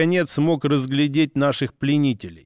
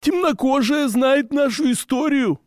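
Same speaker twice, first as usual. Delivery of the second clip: proud orc voice; 10 semitones higher than usual. Acted.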